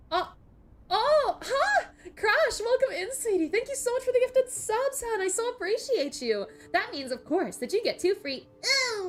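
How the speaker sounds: high, loud squealing gratitude; gushing thanks. Real, not acted. Streamer squeals with delight thanking a viewer for gifted subs. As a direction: gush over the gift subs with real delight.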